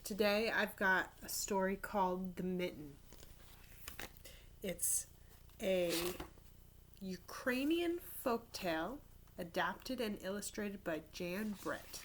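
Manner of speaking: ordinary delivery, nothing marked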